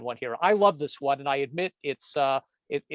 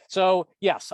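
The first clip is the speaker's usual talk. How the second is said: usual speaking voice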